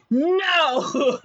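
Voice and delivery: loud, high-pitched refusal; mock defiance. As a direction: belt out a hammed-up defiant refusal. Wife defies the mayor's order.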